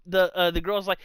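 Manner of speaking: ordinary talk, nothing marked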